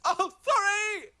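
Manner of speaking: high, loud, drawn-out apology; surfer apology. Acted. The Triton surfer apologizing as waves appear, high and drawn out. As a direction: sing out the sorry. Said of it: "And he goes".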